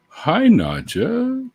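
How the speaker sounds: high, slow drawn-out hello; sing-song greeting. Real, not acted.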